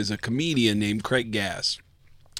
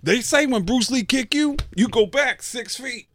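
high, lightly voiced Tracy explaining; Tracy impression; 13 semitones higher than usual. [name] imitating Tracy telling the kick story, high. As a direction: play Tracy telling the legend, pitched up. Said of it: "And he goes".

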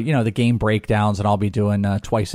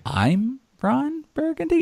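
high, slow, puzzled-sounding query on "I'm Ron Burgundy"; Ron Burgundy questioning; slower than usual. Acted. Host joking about a handle, exaggerated questioning. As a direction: lift "Burgundy" right up like a teleprompter question mark. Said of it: "it's a question".

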